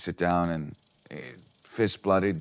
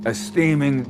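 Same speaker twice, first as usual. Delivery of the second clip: ordinary talk, nothing marked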